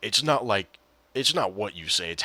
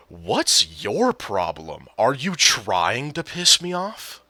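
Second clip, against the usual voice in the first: slow, drawn-out, louder mock outrage; imitated indignant confrontation; 3 semitones higher, 5 dB louder, much slower than usual. Teammate reacting to a one-word answer, mock indignant. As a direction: stretch each word as the offended teammate, rising at the end. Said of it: "Unironically, he says that".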